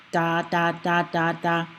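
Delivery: "da" five times in an even run; tongue behind the teeth on each "da"; D sound drill. Shown as a demonstration. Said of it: "Now say the D sound".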